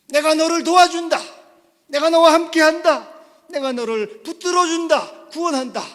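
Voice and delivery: loud, high, emphatic pronouncement; forceful divine proclamation. Acted. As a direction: thunder out the promise as the Lord speaking. Preacher voices the Lord's assurance.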